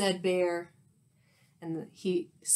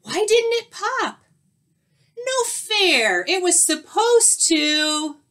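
very high, loud whining disbelief; squealing, thwarted storybook skunk; cried protest; 9 semitones higher, 7 dB louder than usual. A narrator's reading. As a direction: wail that you've been cheated. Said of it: "cried Skunk".

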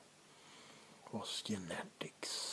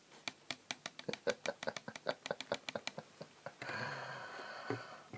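gloating laugh; 7 semitones higher than usual. Acted. Vampire gloats over captive.

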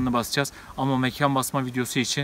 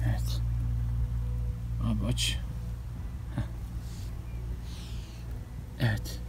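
hushed; 3 semitones lower, 10 dB quieter than usual. Real, not acted. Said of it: "Bu arada biraz sessizleşelim".

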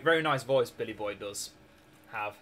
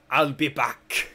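Terminator line; 5 dB louder, much slower than usual. Retold or imitated. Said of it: "I just got that reference, like, from Terminator".

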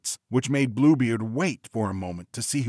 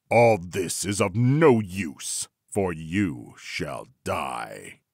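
menacing Bluebeard; 3 semitones lower, much slower than usual. A narrator's reading. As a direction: drag it out, dropping down on the last words. Bluebeard pronounces her death.

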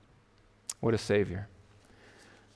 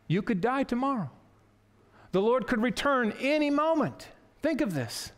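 raised, pressing warning of the closing door; urgent warning; 6 semitones higher than usual. Acted. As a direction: warn them it could close any moment. Preaching warns door will close.

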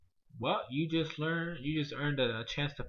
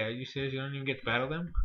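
ordinary talk

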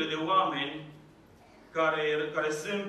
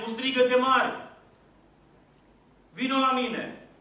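raised, fervent sermon voice; preaching; 4 semitones higher than usual. Acted. Pastor urging his congregation, fervent raised preaching. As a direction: preach it out to the church with rising force.

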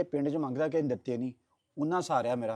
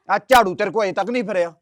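loud, furious jab; angry shout; 3 semitones higher, 10 dB louder than usual. Acted. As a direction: yell it at her. Son shouting in quarrel.

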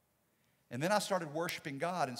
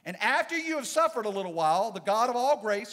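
suffered hit hard and loud, rising; proclaiming the verse at full voice; preached louder; 5 semitones higher, 9 dB louder than usual. Shown as a demonstration. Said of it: "don't you just love that?".

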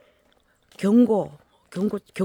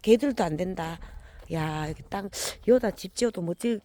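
ordinary delivery

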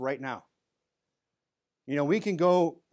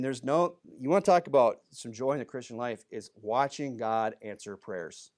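ordinary talk, nothing marked

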